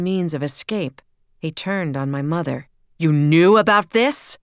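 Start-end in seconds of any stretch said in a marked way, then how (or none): 3.0-4.4 loud, higher accusing question; rounding on his wife; accusing shout. A narrator's reading. Father turns on the mother.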